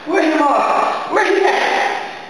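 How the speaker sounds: high, pressing, slowing questions; frantic mother searching for her girls; panicked questions about the master; frantic asking. Acted.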